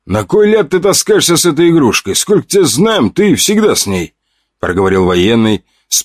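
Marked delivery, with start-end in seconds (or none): 0.1-4.1 raised, rough soldier's voice. Acted.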